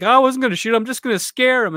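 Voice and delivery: high and loud mock protest; a hammed-up sheepish dad; mock dad excuse. Dad's excuse, acted quote.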